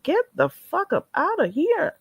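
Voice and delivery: usual speaking voice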